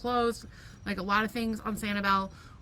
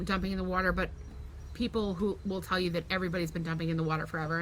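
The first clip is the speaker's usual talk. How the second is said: ordinary talk, nothing marked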